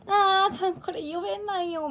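high-pitched mock protest in Iyo dialect; 困った訂正. 関西弁と褒められた時の反応. (関西弁と言われ、高い声で困ったように伊予弁だと訂正)

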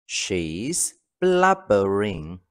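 each syllable drawn out very slowly; slow it right down so learners catch every sound; very slow model reading. Shown as a demonstration. Teacher dictating to the class, very slow. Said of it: "Ví dụ, cô ấy đang khóc sưng cả mắt".

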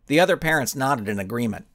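plain reading voice

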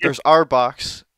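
slow loud call out; slow shouted answer. Real, not acted. Shouting answer over friend.

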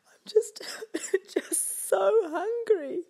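high, slow crying wolf; snivelling. Acted. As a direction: sob out the words. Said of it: "he snivelled".

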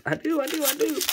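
high, giddy squeal; excited squeal. Real, not acted. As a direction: squeak it out in delight. Excited at spotting a card.

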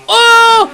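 loud high-pitched "uh" scream; startled yell. Real, not acted. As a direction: cry out in sudden fright. Jump scare in horror game.

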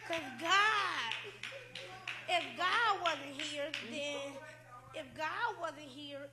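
loud testifying cry from the pulpit; preaching. Real, not acted. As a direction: preach it out at full force. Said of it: "He's a preacher right there".

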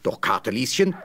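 plain reading voice

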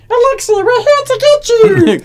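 squeaky helium-high shout. Pretend. Party member mocking Alexi, high helium squeak. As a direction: talk like you just sucked helium. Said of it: "what does Alexi sound like on helium".

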